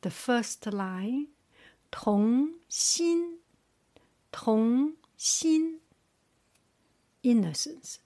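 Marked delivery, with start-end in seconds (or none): 1.9-5.8 童心 with 童 rising, 心 held high and level; second-tone demo. Shown as a demonstration. Teacher drills second tone from the first line, 童心 rising then high level. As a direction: draw out 童 upward, then keep 心 high. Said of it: "Starting from second tone".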